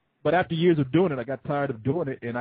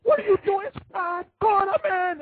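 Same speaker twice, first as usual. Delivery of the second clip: much higher, louder shouted greeting; mock yell; 5 dB louder than usual. Imitating Ryan's all-caps message to Corey as a shout. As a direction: holler it like every word is in caps. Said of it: "what the fuck is this dude so angry about".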